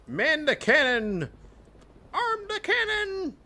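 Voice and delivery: high, slow barked ship orders; shouting orders. Acted. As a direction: call out to the crew. Ship battle, calling orders.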